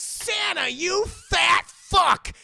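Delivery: high shout, slowing at the end; exclaiming at Santa. Acted. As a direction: holler it, drawing out the insult. Rudolph hails Santa's late arrival.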